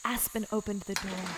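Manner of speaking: ordinary talk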